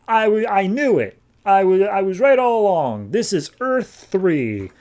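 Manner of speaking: high, stammering glee at being right; excited. Retold or imitated. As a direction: blurt it out, thrilled and vindicated. Xanadu gloats, proven right.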